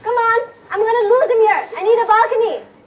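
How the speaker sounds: loud, projected calls across the room; calling out loud. Acted. Actress calling loudly to get a balcony set. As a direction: shout to be heard over the party. Shouting over the party.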